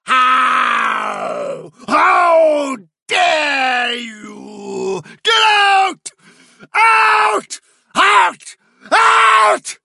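frenzied, very high drawn-out screaming; yelped shriek. A narrator's reading. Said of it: "yelped Fiver".

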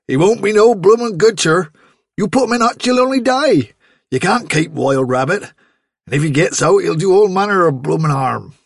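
a farmer lecturing his daughter about rabbits; scolding farmer. Acted. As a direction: lay down the law.

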